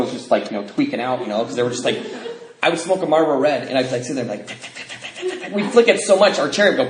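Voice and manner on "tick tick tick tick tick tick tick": soft clicky flicking sound; rapid ticking noise. Retold or imitated. Smoker flicking a cigarette, imitated ticking. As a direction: click out quick little ticks.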